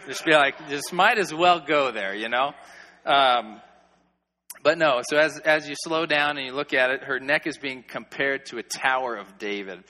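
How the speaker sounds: ordinary talk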